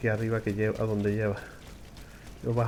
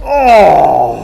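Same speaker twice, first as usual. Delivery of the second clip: startled shout; 5 semitones higher, 16 dB louder than usual. Real, not acted. Close call in game.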